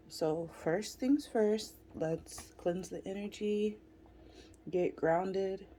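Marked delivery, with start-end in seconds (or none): none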